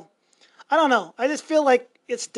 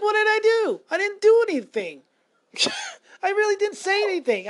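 baffled; 11 semitones higher, faster than usual. Retold or imitated. Retelling bafflement, pitch jumps high.

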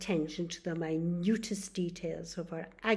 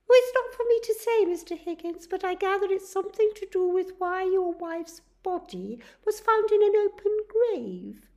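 much higher, louder, fast nurse voice; nurse explaining; 13 semitones higher, 12 dB louder, faster than usual. Acted. Nurse explains why the police want Charlie, high and quick. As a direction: rattle it off matter-of-factly.

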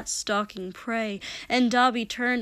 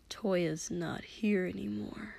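soft, slow and subdued; quiet finality; 2 semitones lower, 8 dB quieter than usual. A narrator's reading. Dabi telling his brother he is gone, quiet.

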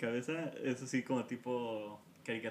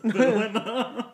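high laughing slow words; 10 semitones higher, 11 dB louder, much slower than usual. Real, not acted. Laughing at own clumsiness.

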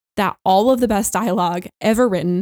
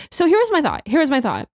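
excited build-up; 7 semitones higher than usual. Real, not acted. Excited theory start.

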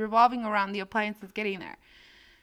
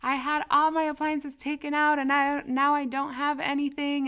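exaggerated plea; 6 semitones higher, 4 dB louder than usual. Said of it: "echándole más crema a los tacos".

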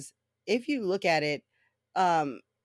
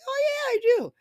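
much higher, louder fake enthusiasm; mock eager; 6 dB louder than usual. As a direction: gush like a gullible mark. Playing along with a tech scammer.

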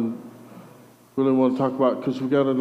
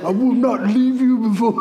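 loud, high-pitched mock New Zealand accent; hammed-up fantasy hero with a New Zealand lilt; Game of Thrones New Zealand accent; 10 semitones higher, 5 dB louder than usual. Preacher showing how Jacob sounds in his head, mock New Zealand accent. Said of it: "Game of Thrones and somebody from New Zealand".

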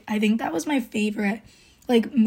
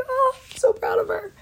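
gushing proud squeal. Retold or imitated. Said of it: "and I was like".

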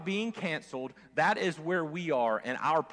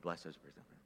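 under his breath; 10 semitones lower, 14 dB quieter, faster than usual. Pretend. Reluctant prayer said to himself.

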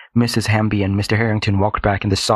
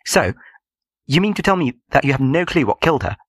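incredulous challenge; 5 semitones higher than usual. A narrator's reading. Student interrupts officer, challenging him.

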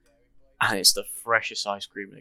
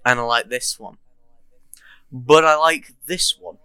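Aldi advert voice; 7 dB louder than usual. Retold or imitated. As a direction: recite it like the advert, stretching the final one. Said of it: "And it's like".

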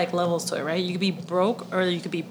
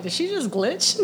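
laughing; 9 semitones higher, 4 dB louder than usual. Real, not acted. Laughing at sister's slip.

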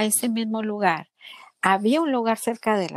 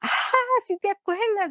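high, delighted reply; delighted surprise; 12 semitones higher than usual. Retold or imitated. Patient to nurse who remembered her, high delighted surprise. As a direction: squeal it, touched she remembers.

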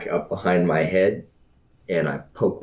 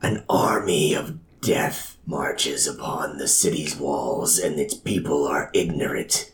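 breathy divine whisper; dramatic whisper. Acted. God speaks into a man's mind, dramatic whisper. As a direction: whisper it like a voice from the gods. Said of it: "a dramatic voice".

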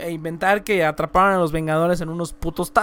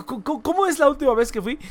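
ordinary talk

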